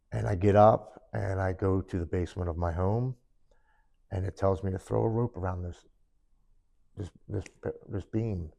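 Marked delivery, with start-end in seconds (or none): none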